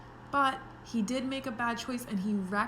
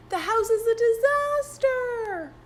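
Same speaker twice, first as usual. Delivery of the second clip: much higher and louder; dramatic dismay; 13 semitones higher, 8 dB louder than usual. A narrator's reading. Teacher retelling to kids, dramatic dismay. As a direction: exclaim the disaster. Reader stresses disaster.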